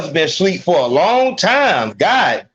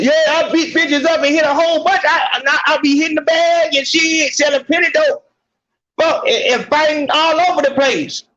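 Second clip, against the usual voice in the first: much higher, loud mock brag with a shout; mocking a loud tough woman; 9 semitones higher than usual. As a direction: parody a brawler bragging on her dating profile. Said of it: "You think you telling".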